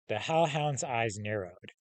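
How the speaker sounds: ordinary talk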